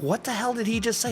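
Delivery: puzzled aside to a friend; baffled question. Retold or imitated. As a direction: act out mild bewilderment, voice lifted. Retelling his baffled question to friend.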